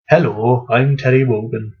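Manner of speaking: low-pitched, shaky attempt at a Welsh accent. Ranter testing whether the broadcaster is Welsh, mock Welsh accent. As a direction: introduce yourself in a badly faked Welsh accent. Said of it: "with a Welsh accent".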